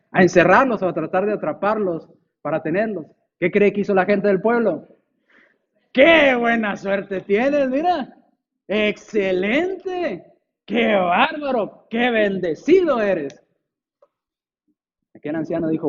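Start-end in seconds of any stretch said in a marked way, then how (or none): 5.9-13.4 slow, drawn-out gushing praise, a bit higher; gushing neighbours. Acted. Neighbours congratulate the old man, slow and gushing. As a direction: neighbours marvel at his luck, stretching every word.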